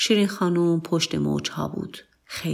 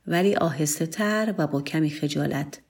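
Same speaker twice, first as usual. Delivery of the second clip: ordinary delivery, nothing marked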